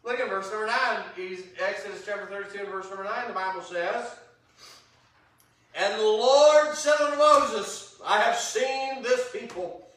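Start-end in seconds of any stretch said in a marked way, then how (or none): 5.8-9.9 louder, slower reading of God's words; preacher reads the Lord's words with weight; scripture reading. Acted. Preacher reading God's rebuke of Israel, heavy scripture voice.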